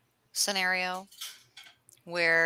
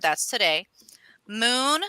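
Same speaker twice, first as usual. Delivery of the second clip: ordinary talk, nothing marked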